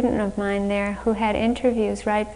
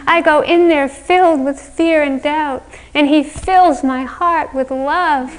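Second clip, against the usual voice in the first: dreamy, gushing confession; swooning; 5 semitones higher, 6 dB louder than usual. Retold or imitated. Student telling her friend on the steps, swooning. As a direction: pour it out, starry-eyed. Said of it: "she was just swooning".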